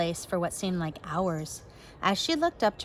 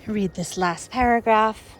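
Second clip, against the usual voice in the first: ordinary delivery, nothing marked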